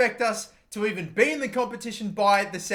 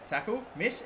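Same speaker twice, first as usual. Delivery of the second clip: ordinary talk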